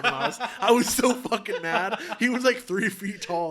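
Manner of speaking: high, loud, laughing rant; laughing indignation. Real, not acted. Recalling anger at the tiny man, loud laughing indignation. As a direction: vent through real laughter.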